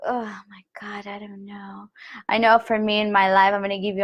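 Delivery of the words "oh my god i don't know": hushed worried whisper; small, fearful whispered doubt; anxious whisper. Shown as a demonstration. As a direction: fade into an unsure whisper. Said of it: "do you feel like".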